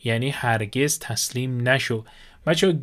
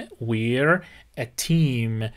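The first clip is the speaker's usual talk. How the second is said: very slow, we're run into one syllable; slow model phrase; 2 semitones lower, much slower than usual. Shown as a demonstration. Teacher modelling the phrase for students, very slow. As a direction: model it slowly for the class. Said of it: "تلفظ کلمهٔ we're".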